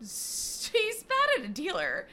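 high, loud burst of disbelief; incredulous echo. Real, not acted. Echoes co-host's remark in disbelief.

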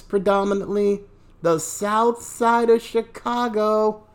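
ordinary delivery

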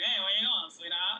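lightly voiced old-timey man; a hint of an old-timey fellow, laughing at the end. Co-host trying the accent, lightly voiced old-timey man. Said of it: "mine always is the man".

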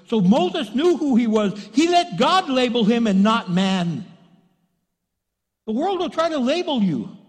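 loud, high, driving sermon voice; emphatic preaching. Real, not acted. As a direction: preach the point home at full voice. Preacher drives home his point.

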